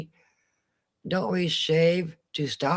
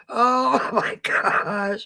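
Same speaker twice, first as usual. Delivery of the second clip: slow, higher 'oh' breaking into laughter; real laughter; 5 semitones higher, much slower than usual. Real, not acted. Recovering from a needed laugh, still cracking up. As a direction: laugh helplessly through the words. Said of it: "We needed this laugh".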